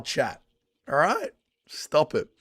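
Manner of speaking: ordinary talk, nothing marked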